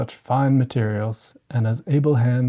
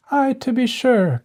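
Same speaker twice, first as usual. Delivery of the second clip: much higher mocking sister's voice; scornful stepsister; 12 semitones higher than usual. A narrator's reading. Miss Charlotte mocks Cinderella, high scornful voice. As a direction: sneer it sarcastically. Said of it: "cried Miss Charlotte".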